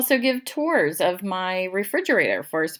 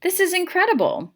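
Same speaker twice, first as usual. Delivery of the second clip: amazed; 12 semitones higher than usual. A narrator's reading. Mac amazed by the museum.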